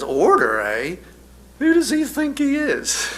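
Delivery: high, scoffing peasant woman, with a laugh in it; mocking peasant woman. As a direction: jump up high and sneer at the king's order. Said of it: "suddenly says".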